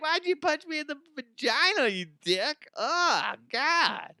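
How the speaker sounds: high-pitched pained whine; hammed-up high groaning complaint; imitated woman in pain. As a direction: whine in a mock female voice. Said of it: "she'd be like".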